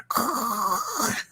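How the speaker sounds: harsh throat rattle as if choking; failed purr attempt. Wife re-enacting her try at husband's purr, choking rattle. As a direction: re-enact a purr gone wrong. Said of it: "I try and do it, and I'm like".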